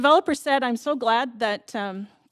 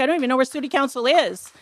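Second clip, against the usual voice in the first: higher, louder anxious tenant voice; voicing nervous tenants; 5 semitones higher, 3 dB louder than usual. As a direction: channel the frightened tenants. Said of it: "They're like".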